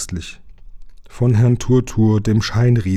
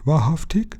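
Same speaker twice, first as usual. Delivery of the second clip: thin, high giant's voice; dünne Stimme; 7 semitones higher than usual. A narrator's reading. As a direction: ask in a small, raised voice. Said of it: "die dünne Stimme des Scheinriesen". (dünne, hohe Fragestimme)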